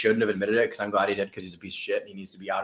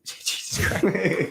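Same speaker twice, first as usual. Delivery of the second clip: breathless laughing exclamation; gasping through a laugh; 8 semitones higher, much slower than usual. Real, not acted.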